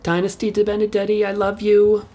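ordinary delivery

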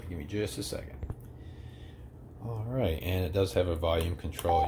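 ordinary talk

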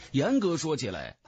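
ordinary delivery, nothing marked

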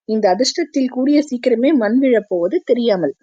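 plain reading voice

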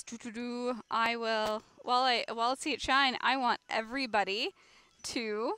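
ordinary talk

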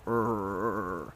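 low, soft grunt as the fish; mock grunt for the catfish; voicing the catfish. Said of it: "Mister Catfish says".

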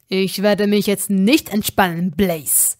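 loud, angry retort; refusing to relax, snapping angrily at Blaze; snapping at him. Acted. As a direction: bite back at your friend. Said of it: "pumpte er ihn an".

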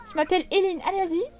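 put-on little girl ordering her sister to get going; bossy little girl. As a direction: boss her sister around a bit. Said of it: "c'est Hélène qui dicte à sa sœur".

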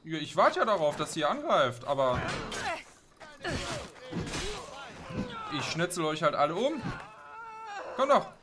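loud, high mocking taunts at enemies; loud taunting. Real, not acted. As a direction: yell it out, goading the enemies.

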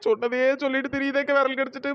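ordinary talk

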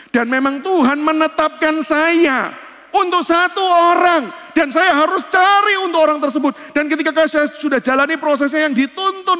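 high, loud preaching climb; fiery preacher lifting the voice high and loud; full-force preaching. Acted. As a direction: proclaim it to the whole congregation.

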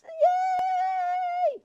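squealed yay, pitched far up and loud; a delighted squeal of victory; excited yay. Real, not acted. Vlogger cheering her win, a high squeal. Celebrating winning the drawing.

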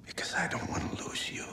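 soft whispered confession, barely voiced; tender whisper. [name] whispering his fear of losing Jody. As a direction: breathe it close, caring.

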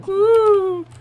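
high, loud "woo" held long; real high-pitched whoop. Real, not acted. Player whooping at a floaty leap, whoop.

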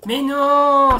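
long, loud, high protest; dismayed yell. Real, not acted. Player didn't mean that move, dismayed yell.

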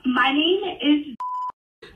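very slow, careful enunciation with long gaps; slow and clear. Real, not acted. Telemarketer repeating for a confused customer, slow and careful. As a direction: spell it out word by word, stretched. Caller obeys request to speak slowly.

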